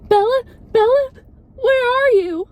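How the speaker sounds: high, loud mock-panicked calling. Mother searching for Bella in the ballet studio, mock panicked. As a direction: call out for a missing daughter, hammed-up panic. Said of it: "Weird that she sounded so panicked".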